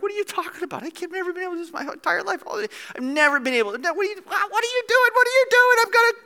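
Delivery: high, quick protest building to shrill shouting; mock panic. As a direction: protest frantically as if hauled upright. Acts out panicking lame person.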